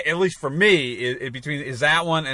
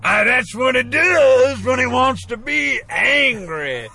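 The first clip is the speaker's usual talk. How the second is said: put-on Southern accent; 7 semitones higher, slower than usual. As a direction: boom it out slow like an angry Southern lawyer. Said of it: "doing the Southern accent".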